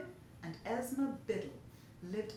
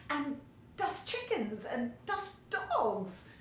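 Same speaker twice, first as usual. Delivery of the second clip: mock squeal, drawn out slowly; a light, slow squeal of delight; 5 semitones higher, 3 dB louder, slower than usual. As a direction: squeal it out like a giddy girl. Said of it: "Trudy squealed".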